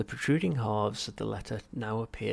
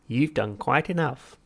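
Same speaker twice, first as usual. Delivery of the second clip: slow, loud dismissive voice; curt machine; 4 semitones higher, 9 dB louder than usual. Acted. Machine dismissing Arthur's help, curt. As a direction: cut him off coolly.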